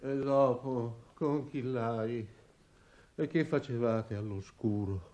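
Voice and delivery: barely audible, as if just coming round; faint, dazed. Acted. Dazed father questioning Esopo about the dark and smoke, faintly.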